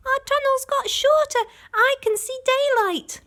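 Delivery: very high, loud little cub shout; a small child spotting something; excited cub. A narrator's reading. Small fox calls out.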